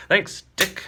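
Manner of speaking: a hint of a proud superhero; dignified hero. Hero composes himself, dignified.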